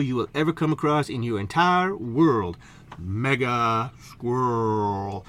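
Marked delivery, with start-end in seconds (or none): 3.0-5.2 slow, drawn-out low Mega Squirrel; grand name. Shown as a demonstration. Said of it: "if you're gonna tease my name".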